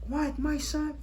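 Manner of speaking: high, breathless disbelief; can hardly believe it; stunned. Acted. Father told his son became a rabbi, stunned high and soft.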